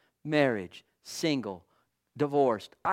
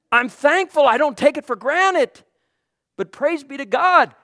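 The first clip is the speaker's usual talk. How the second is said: loud, higher sermon fervour; preaching; 7 semitones higher, 7 dB louder than usual. Real, not acted. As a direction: rouse the congregation. Preaching at full force.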